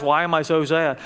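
ordinary delivery

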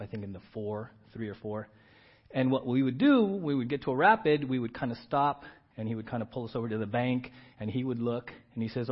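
ordinary talk, nothing marked

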